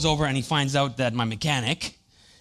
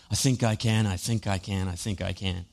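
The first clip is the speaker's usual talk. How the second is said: mock tired engine, trailing off softer and lower; fading little engine; 6 semitones lower, 3 dB quieter than usual. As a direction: run out of steam on each I think I can. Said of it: "You ever feel like you're not firing on all cylinders?".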